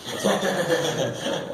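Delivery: amused, laughing through the words. Real, not acted. Teacher delighted by a student's answer, laughing.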